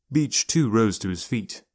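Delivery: ordinary delivery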